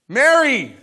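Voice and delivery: loud, high call of Mary!; urgent exclamation; called out name. Shown as a demonstration. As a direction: call her name like a shepherd. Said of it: "Mary with an exclamation point".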